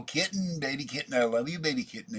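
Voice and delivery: put-on high cooing baby talk; sing-song baby talk to a cat; cooing to kitten. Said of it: "I go".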